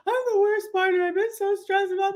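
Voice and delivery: high, frantic mock wail; mock distraught wail. Wife replaying her breakdown to her husband, high frantic wail. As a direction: replay the panic, voice flying up.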